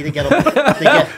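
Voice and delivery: words breaking into laughter, loud and high; laughing. Real, not acted. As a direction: laugh your way through the line. Laughing while telling story.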